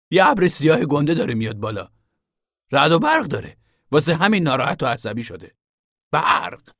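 a lean man's quick verdict; thin man. A narrator's reading.

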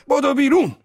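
very high, loud hoarse scream; hoarse rough yell. A narrator's reading. Zorba warning everyone to run out, hoarse yell. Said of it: "با صدای گرفته و خشن داد زد".

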